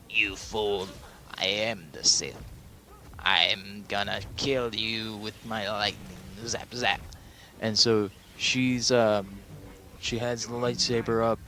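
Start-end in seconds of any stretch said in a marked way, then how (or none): 0.1-7.0 hammed-up evil overlord; Emperor Palpatine. Evil emperor attacking Rey with lightning, mock-menacing villain voice. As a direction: stretch the words out like a gloating villain. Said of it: "Emperor Palpatine directs all of his power".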